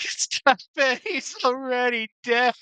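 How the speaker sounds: high, mock pleading cartoon voice; Simpsons meme quote. Quoting a Simpsons meme about a berserk king, high cartoon voice. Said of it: "get that Simpsons meme going on where he's like".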